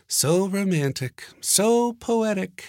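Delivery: high, slow, mocking woman's voice; dry mock praise murmured at a panel; sarcastic murmur. A narrator's reading. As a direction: tease him for being unromantic. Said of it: "she murmured".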